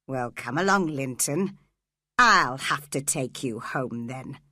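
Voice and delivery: a lightly voiced stern man, low; low stern father. A narrator's reading. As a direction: coax the boy home, lightly menacing.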